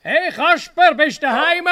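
calling a friend from outside the house. Acted. Calling out at the door.

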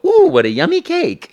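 high, thrilled "ooh" of delight. Retold or imitated. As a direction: squeal over a tasty cake. People admiring the finished game.

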